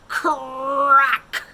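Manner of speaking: loud, slow and high; slow, swelling loud narration; dramatic build. A narrator's reading. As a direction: build the suspense to the crack. Crash builds in story.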